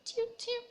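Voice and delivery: high piping toot toot; bird call. Acted. Narrator voicing an unseen bird in the bushes, high piping call. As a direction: chirp it like a little bird.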